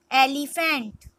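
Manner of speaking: usual speaking voice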